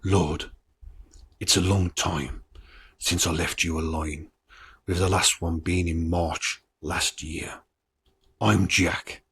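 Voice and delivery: low, slow, lightly eerie read of the letter; a hint of the hoaxer's creepy calm; reading the hoax tape. Reading the Wearside Jack tape to viewers, low and slow. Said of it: "I'm not gonna do the accent, but I'm gonna read".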